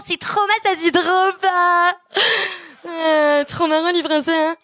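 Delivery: high, hammed-up Parisian French accent; baffled Frenchman. French listener baffled by Quebec expression, exaggerated French accent. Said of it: "Puis chaque réponse, toujours la même chose".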